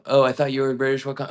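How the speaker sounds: ordinary talk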